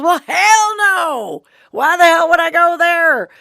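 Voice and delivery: loud, high-pitched retort, drawn-out "hell no"; incredulous outburst. Retold or imitated. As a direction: scoff loudly, imitating a farmer's disbelief. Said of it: "he belly laughs".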